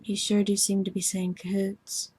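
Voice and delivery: ordinary talk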